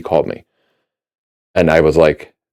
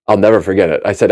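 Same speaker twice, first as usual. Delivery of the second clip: usual speaking voice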